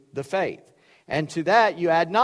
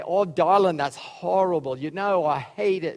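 put-on New York accent. Bridal shop worker judging a dress, hammed-up New York accent. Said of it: "that was my best New York accent".

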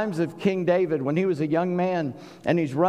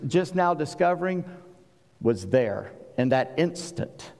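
ordinary talk, nothing marked

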